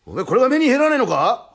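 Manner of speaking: loud, high-pitched intimidation; blustering threat. Acted. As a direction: demand he look at the blade. 刃物を見せて脅す. (大声ですごむ)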